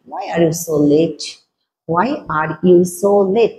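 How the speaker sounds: slow, word by word, for learners; slow model sentence. Shown as a demonstration. Teacher slowly modelling the sentence for students. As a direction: spell it out slowly for the class. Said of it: "দেখো একটু ভালো করে খেলো".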